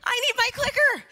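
high, loud hammed-up wail over the lost clicker; mock panic. Speaker retelling her backstage panic, hammed-up high wail. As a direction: play the panic big and silly. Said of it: "And I'm all".

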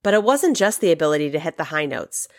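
ordinary talk

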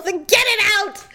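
high-pitched frantic command; retold screaming. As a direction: re-enact frantic screaming at the driver. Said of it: "I was screaming".

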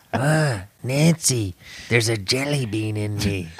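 hammed-up old president; Ronald Reagan impression. Host voices Reagan telling wife he's shot, laughing. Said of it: "Is that how he sounds?".

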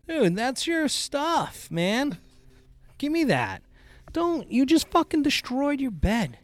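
high, slow exasperated scolding; scolding the dog. Real, not acted. Owner to dog that shredded her bed, high slow scolding. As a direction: drag out the words in disbelief at the dog.

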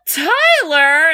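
mock shocked question; shocked realization. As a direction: ask it in disbelief. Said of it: "He's like".

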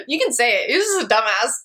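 laughing through the words; real laughter over a blunt joke. Real, not acted. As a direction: giggle while saying it. Laughing after her blunt joke.

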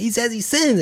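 put-on high-pitched tattling kid voice; excited son. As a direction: imitate a boy reporting naughty talk to dad. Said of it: "he's excited".

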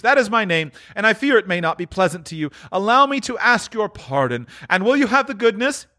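high, earnest character voice; Scrooge, apologetic. Acted. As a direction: ask pardon in a high, eager voice. Scrooge asks the gentleman's pardon.

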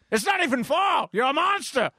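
hammed-up horrified scold; mock outrage.

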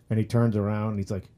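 ordinary talk

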